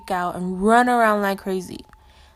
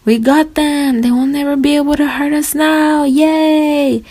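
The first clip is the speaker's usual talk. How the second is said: mock scream; 6 semitones higher, 7 dB louder than usual. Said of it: "they started to scream".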